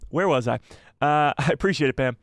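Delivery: ordinary talk, nothing marked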